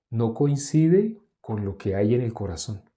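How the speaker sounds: ordinary talk, nothing marked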